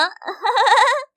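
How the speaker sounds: high mocking cackle from the crowd; a burst of jeering laughter. Classmates laughing at her.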